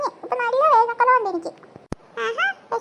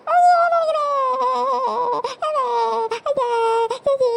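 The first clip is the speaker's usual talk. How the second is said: wailing in pain.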